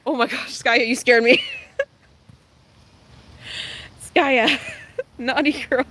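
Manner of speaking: startled yelp that breaks into laughter; jump, then giggle it off; scream into giggles. Real, not acted. Dog startles her.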